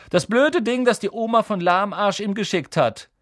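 high, loud mocking boy; sneering Malfoy. Malfoy mocking Neville's gift, sneering and high. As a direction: sneer at the stupid thing.

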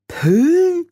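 high, questioning read-out; puzzled reading aloud. A narrator's reading. Frau Grad reads Rob's note aloud, puzzled and high. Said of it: "las sie".

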